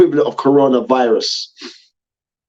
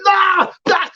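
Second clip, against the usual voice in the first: high shouted refusal; shouted protest. Retold or imitated. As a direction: yell it at the screen in protest. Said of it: "You was like".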